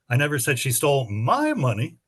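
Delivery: emphasize whose money, my; stress on my. Shown as a demonstration. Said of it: "read that sentence with seven different emphases".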